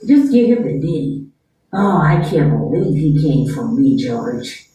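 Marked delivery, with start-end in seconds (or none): 1.7-4.7 low, disgusted rich mom voice to George. Acted. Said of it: "let your son bring me down".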